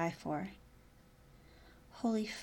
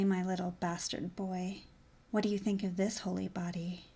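normal talking voice

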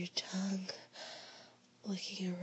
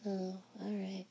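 ordinary talk, nothing marked

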